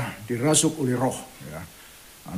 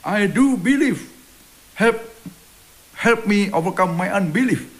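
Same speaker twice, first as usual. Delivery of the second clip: exclaiming; 6 semitones higher, slower than usual. Retold or imitated. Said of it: "the boy's father exclaimed".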